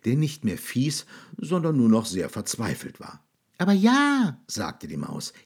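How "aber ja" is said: high, loud squeaky voice; mouse reassuring. A narrator's reading. Mouse reassures the sad monster, high and loud. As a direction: cheer him up like a little mouse.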